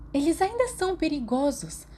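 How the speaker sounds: insist, voice up high; Marlene voiced.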